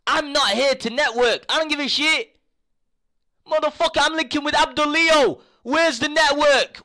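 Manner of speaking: high, loud indignant yelling; angry rant. Real, not acted. Streamer yelling at a rival who claimed to network, furious. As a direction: blow up at the insult.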